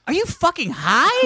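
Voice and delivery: high, stunned disbelief; incredulous outburst. Retold or imitated. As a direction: blurt it out in shock. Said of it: "I'd be like".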